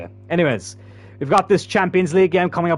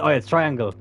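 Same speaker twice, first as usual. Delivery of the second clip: ordinary talk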